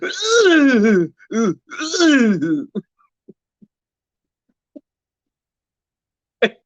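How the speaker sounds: hammed-up moaning; overdone fake moan; mock moan. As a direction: imitate the lady again, teasing. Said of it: "she's like".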